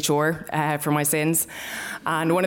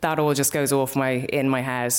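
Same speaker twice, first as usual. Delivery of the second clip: ordinary delivery, nothing marked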